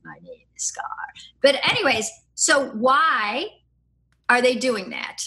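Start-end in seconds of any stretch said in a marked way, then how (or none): 0.1-0.9 much lower, softer growl of Scar; Scar accent retry. Retold or imitated. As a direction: drop deep and quiet for the villain lion. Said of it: "I can't even do that accent".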